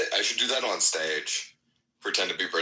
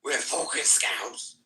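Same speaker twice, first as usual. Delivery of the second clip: Scouse shout; 11 semitones higher, slower than usual. Retold or imitated. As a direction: yell it like drunk Scousers in a bar, Scouse accent. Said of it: "and they're like".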